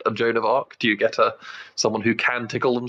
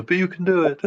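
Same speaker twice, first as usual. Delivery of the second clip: higher, put-on encouraging voice; playful copy of a pep talk, pitched up; imitating pep-talk voice; 8 semitones higher than usual. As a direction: mimic a friend's cheery inner coach. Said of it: "you did put on a voice".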